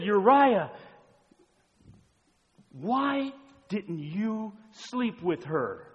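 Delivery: loud, high, drawn-out demand; exasperated preaching. Acted. Preacher as David demanding answers from Uriah, exasperated. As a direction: stretch the question out slowly and forcefully.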